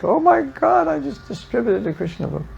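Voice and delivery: high, squealing thrill that fades to a hush; giddy excitement. Retold or imitated. Young devotee after each book sold, high giddy thrill. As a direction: burst out high with glee, then drop soft. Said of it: "And every book was like".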